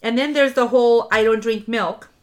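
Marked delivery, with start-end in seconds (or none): none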